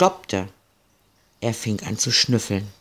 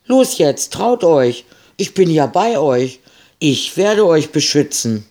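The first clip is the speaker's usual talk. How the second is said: much higher, louder encouraging little dog voice; eager brave dog; 5 semitones higher, 4 dB louder than usual. A narrator's reading. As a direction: cheer the kids on like a plucky pup. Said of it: "als wollte er sagen".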